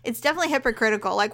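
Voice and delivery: usual speaking voice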